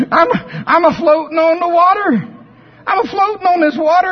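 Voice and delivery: high, loud chicken character bobbing on water. Acted. Chicken Chuck calls out happily on the water, high voice. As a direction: pitch him way up as the naive chicken.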